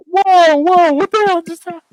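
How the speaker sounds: loud, very high startled cry; hammed-up alarm, voice shooting way up; mock shock. Bar locals stunned by the swearing, loud hammed-up shock. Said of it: "they'd just be like".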